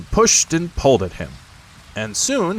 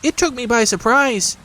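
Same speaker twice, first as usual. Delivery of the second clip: nervous Piglet; 4 dB louder than usual. Acted. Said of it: "said Piglet nervously".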